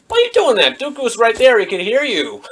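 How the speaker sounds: high-pitched incredulous protest; mock outrage at a foolish move; exasperated scolding. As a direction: scold in squeaky disbelief, easing down. Scolds Obi-Wan for speaking aloud.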